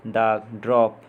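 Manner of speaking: usual speaking voice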